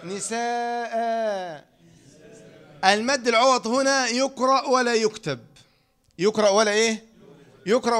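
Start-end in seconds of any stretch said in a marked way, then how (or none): 0.0-1.7 نساء stretched very slowly and softly at the stop; drawn-out pausal demo. Shown as a demonstration. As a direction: hold نساء long as the stop form. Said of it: "عند الوقف إيه". (نطق نساء ممدودًا عند الوقف)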